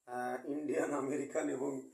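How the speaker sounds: ordinary delivery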